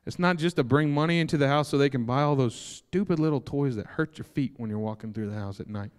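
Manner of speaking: normal talking voice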